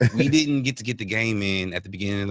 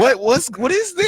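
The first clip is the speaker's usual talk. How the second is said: surprised; 12 semitones higher, 5 dB louder than usual. Retold or imitated. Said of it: "I was like".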